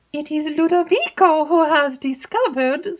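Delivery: put-on high, breathless chatter of an excitable young maid; alarmed lady's maid spilling gossip. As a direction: gabble it like a nervous servant girl. Annette blurts.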